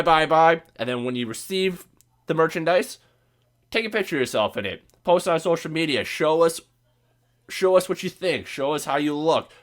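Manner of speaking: ordinary delivery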